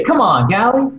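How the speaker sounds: mock exit slam. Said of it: "The exit slam".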